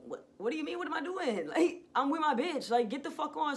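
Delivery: high, loud indignant outburst; heated, incredulous retort; annoyed, agitated. Retold or imitated. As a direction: fire back at an intruder, fed up. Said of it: "I'm annoyed. I'm agitated".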